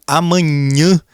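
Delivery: amanhã with the final ã sent through the nose; nasal vowel demo. Shown as a demonstration. Teacher showing students nasalization on amanhã. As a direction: stretch amanhã, nasal ã at the end. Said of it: "parte do ar vai sair ali pelo nariz".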